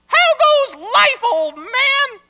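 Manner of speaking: very high, loud cheeky puppet; insolent marionette. Acted. Marionette greeting its maker on first speaking, insolent. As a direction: taunt in a high, slow puppet voice. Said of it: "said the insolent marionette".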